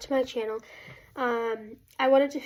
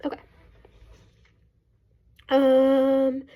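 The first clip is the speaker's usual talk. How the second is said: usual speaking voice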